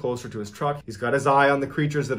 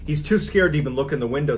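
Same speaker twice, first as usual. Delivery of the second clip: ordinary talk, nothing marked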